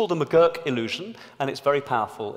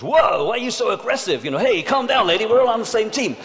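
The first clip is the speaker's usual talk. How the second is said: higher, louder, patronising mock voice; hammed-up condescending coworkers telling her to calm down; mocking the colleagues who brush a woman off; dismissive meeting colleagues; 9 semitones higher, 8 dB louder than usual. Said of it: "everyone else in the meeting goes".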